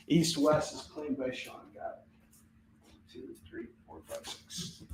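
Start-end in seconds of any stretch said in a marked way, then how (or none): 3.1-4.8 whispering the count to himself; a soft under-the-breath tally; whispered count. Real, not acted. Counting quietly under his breath.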